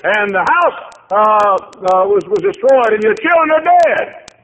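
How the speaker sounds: high, loud preacher's cry; preaching at full force. Acted. Preacher crying out Job's losses to the congregation, full force. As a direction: shout the loss out over the pews.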